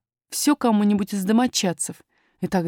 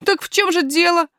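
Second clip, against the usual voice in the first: higher, louder puzzled question; pitched-up boyish surprise; surprised boy; 10 semitones higher, 5 dB louder than usual. A narrator's reading. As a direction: ask in a puzzled young lad's voice. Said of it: "удивился мальчик".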